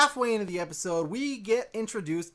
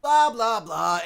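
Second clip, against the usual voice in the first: dismissive blah; 4 semitones higher, 9 dB louder, slower than usual. Real, not acted.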